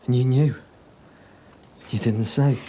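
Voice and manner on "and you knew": louder, bitter accusation; accusing his wife, louder. Acted. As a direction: land it like a charge.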